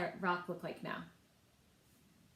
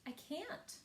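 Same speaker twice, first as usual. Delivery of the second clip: sorry reply; 4 semitones higher, 6 dB quieter, slower than usual. Acted. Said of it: "but replied".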